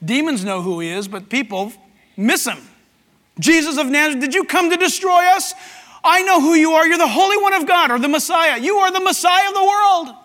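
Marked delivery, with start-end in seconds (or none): 3.4-10.1 freaked-out demon. Acted. Demon confronting Jesus of Nazareth, crying out in alarm. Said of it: "This demon's freaking out".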